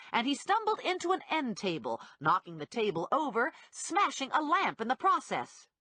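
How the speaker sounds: plain reading voice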